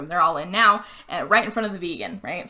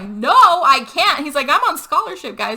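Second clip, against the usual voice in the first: high, loud mock pleading; pleading protest; 5 semitones higher, 6 dB louder than usual. Luke retold told to smash his knee, loudly pleading.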